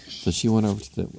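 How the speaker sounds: ordinary delivery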